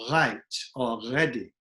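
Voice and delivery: RIGHT and READY with a throaty French R. Shown as a demonstration. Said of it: "they would say the word".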